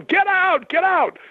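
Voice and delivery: retold Donna banishing the spirit, loud and high; mock exorcism shout. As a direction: yell at the spirit to leave. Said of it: "she was really going to town".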